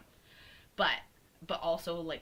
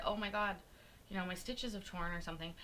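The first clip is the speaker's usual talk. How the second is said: ordinary delivery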